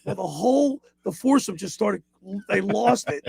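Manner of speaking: ordinary delivery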